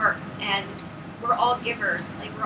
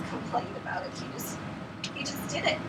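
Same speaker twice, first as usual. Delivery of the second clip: whispered, moved; 8 dB quieter than usual. Real, not acted. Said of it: "emotional praise of husband".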